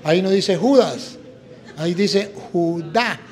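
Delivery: "Judas" and "Judá" set apart slowly, stress landing on the final A of "Judá"; slow correction. Shown as a demonstration. Priest correcting his uncle, slowly stressing "Judá". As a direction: spell out the contrast "Judas" then "Judá", slowing down. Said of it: "tiene acento en la A".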